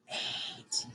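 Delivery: breathy whispered number. Shown as a demonstration. Teacher whispering every other number in a counting drill, whispered. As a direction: whisper it, no voice at all. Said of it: "Now we're gonna whisper every number".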